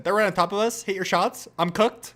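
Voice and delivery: high Fortnite gamer voice, each phrase rising; excited mock Fortnite player with upswept endings; Fortnite accent. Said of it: "that's the Fortnite accent".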